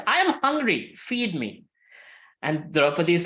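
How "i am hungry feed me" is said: high-pitched insistent demand; playful, imperious demand for food; acted hungry demand. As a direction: announce your hunger high, then drop to a command. Krishna demands food on arriving.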